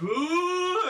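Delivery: high eerie alien wail; rising mock alien screech; body snatcher shriek. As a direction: shriek like a pod person pointing. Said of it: "Like a body snatch was like".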